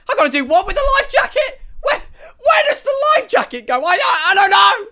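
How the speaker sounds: high, fast panicked squeal; mock panic. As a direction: flail through it like a frantic passenger. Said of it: "I'm panicking".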